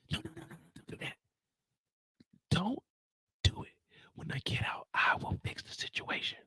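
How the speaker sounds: fast hushed whisper. Retold or imitated. Drew begging Diana not to ride out, whispering fast.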